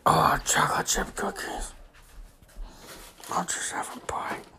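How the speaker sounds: secretive whisper; quiet at night. Real, not acted. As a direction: whisper it sneakily.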